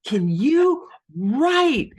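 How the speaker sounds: put-on high, loud and very slow girl-talk voice; sassy mocking mentor. Mentor drawing out his praise to the author, high, loud and slow. Mentor's blunt question, imitated.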